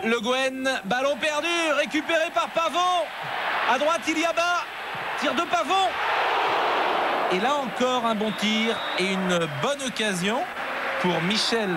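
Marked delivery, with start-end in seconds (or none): 0.8-5.9 rising, quick play-by-play; excited sports commentary. Real, not acted. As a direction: speed up to the shot. Counterattack ending in shot.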